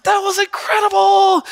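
high, loud awestruck shout; excited raving; amazed exclamation. Retold or imitated. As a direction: gush about the sermon. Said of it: "you're just like".

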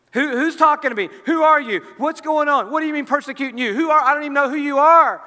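high, loud, urgent confusion; bewildered questioning. Acted. Acting out Paul's bewildered questions.

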